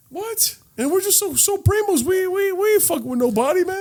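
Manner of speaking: high indignant innocence; squeaky fake innocence; mock innocent whine. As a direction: play it high and wounded.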